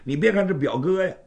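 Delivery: plain reading voice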